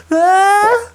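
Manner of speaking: loud high-pitched scream; running scream. Acted. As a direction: scream while charging down a wall. Voicing Timmy running down the wall.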